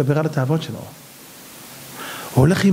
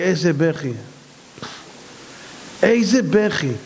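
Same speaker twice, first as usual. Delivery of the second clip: ordinary talk, nothing marked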